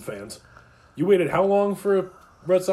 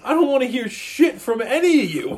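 raised, heated fan rant; loud rant; 6 semitones higher, 5 dB louder than usual. Real, not acted. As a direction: lay into them, loud.

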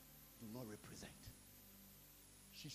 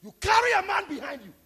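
furious shouted accusation, much higher and louder; angry shout; 27 dB louder than usual. Real, not acted. Preacher scolding Kamala over her visit, angry shout. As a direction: hurl it at her in outrage.

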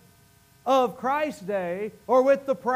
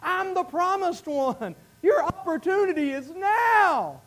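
high, earnest pleading call; Jesus pleading through tears with the crowd; weeping plea; 7 semitones higher, slower than usual. Acted. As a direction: beg them to see him. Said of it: "Jesus is standing there weeping and saying".

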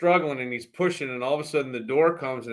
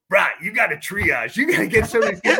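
imitating Weeze; 7 semitones higher, 6 dB louder than usual. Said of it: "he would turn to me and go".

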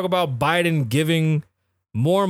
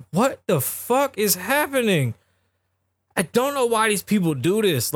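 stunned Trump; 6 semitones higher than usual. Retold or imitated. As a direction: drag it out like he can't believe what he's hearing. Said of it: "and he's like".

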